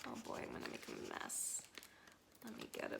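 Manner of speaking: soft unvoiced whisper; hushed under the breath. Real, not acted. Whispers while handling dripping pouch.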